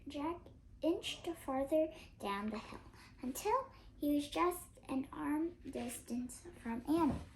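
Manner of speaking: usual speaking voice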